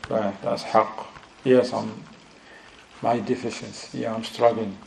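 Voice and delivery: low, soft admission to oneself; imagined self-admission. Acted. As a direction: quietly voice someone owning a shortcoming. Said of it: "He's like".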